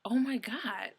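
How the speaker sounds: mock exasperation at the memory; exasperated. Recalling the stolen cheese stick, exasperated. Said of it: "I was just like".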